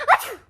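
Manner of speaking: sudden loud high achoo; sneeze. Acted. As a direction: burst out sneezing. Gerald sneezes mid sentence.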